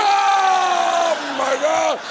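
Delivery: long, high mock wail; shocked dad. Dad learns the truth about the video, shocked. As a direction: stretch it out in horrified awe. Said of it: "He went".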